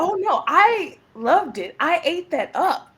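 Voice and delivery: high, slow, drawn-out delight; gushing delight. Real, not acted. Actor to host about her role, gushing. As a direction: savor how much she loved it.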